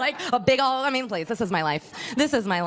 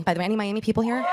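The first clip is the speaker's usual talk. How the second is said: ordinary delivery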